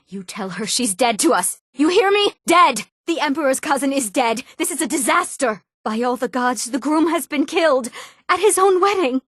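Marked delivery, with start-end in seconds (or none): 1.7-9.2 high-pitched, agitated exclamations; alarmed and scandalized, pitch leaping up; appalled outrage. Acted. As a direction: cry out in horror at a public disaster. Outrage at killing at wedding.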